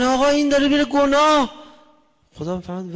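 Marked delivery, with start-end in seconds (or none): 0.0-1.6 much higher and louder, alarmed; angels complaining. Acted. Angels loudly warning God that a man is sinning. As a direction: cry out like worried angels reporting. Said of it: "ملائکه میگن".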